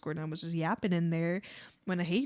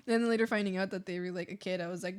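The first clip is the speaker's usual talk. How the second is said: ordinary delivery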